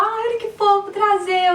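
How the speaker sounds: high doting voice praising a cute mistake; mock doting coo. Mother mock-gushing over her daughter's wrong word, high coo. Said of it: "mas eu não vou falar".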